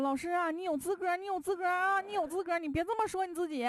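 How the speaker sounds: put-on eager kids yelling together in chorus; high shouted chorus. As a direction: chant it back like a whole class. Students chorus reassuring teacher.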